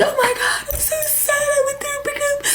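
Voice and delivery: high, whiny fake sobbing; mock sobbing over the breakup, whiny and high; mock crying. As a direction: wail it like a hammed-up heartbroken girl. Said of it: "then I would be like".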